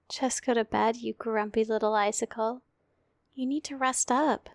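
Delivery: hushed, tender bedtime voice; gentle murmured ASMR comfort. Acted. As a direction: lull a sleepy partner off to sleep. Whole comfort roleplay.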